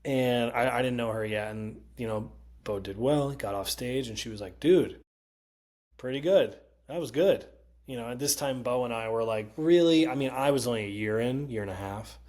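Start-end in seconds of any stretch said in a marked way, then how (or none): 4.6-7.5 higher, louder retold compliment; impressed praise. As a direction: imitate her pleasant surprise, punching it a little. Retold praise after the set.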